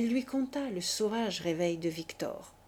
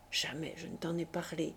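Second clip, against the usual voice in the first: whispered confession; 3 semitones lower, 5 dB quieter than usual. Acted. Unvoiced confession.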